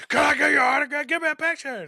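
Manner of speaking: high, loud shouting fan; screaming fan. Pretend. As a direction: squeal like an overexcited fan. Mock fan shouting for autograph.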